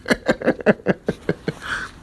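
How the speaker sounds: long, airy, high laugh; real laugh. Real, not acted. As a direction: crack up at the insult. Amused by the insult just read.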